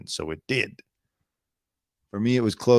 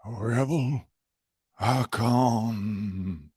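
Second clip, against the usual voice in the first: mimicking the announcer; much slower than usual. Mimics the clip just played.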